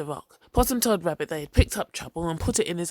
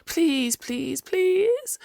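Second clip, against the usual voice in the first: pleading please climbing higher and louder; desperate begging; 11 semitones higher, 3 dB louder, much slower than usual. Acted. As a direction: beg with everything he has. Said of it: "Possum begged and cried".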